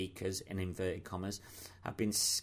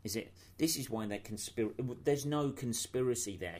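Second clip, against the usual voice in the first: ordinary delivery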